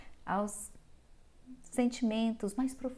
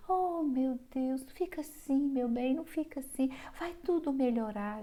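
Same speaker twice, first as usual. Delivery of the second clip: mock soothing; 4 semitones higher, 4 dB quieter than usual. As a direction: coo at her like a pampering carer. Carer comforting the girl.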